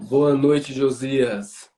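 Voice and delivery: ordinary talk, nothing marked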